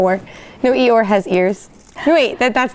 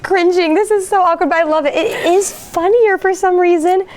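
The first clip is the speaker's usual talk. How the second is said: giddy; 7 semitones higher than usual. Real, not acted. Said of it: "I feel like I'm cringing".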